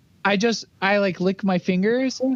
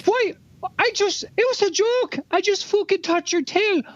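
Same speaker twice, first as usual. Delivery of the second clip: character voice; 10 semitones higher than usual. Acted. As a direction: stretch it out, pitched up high. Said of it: "can I tell him it's like".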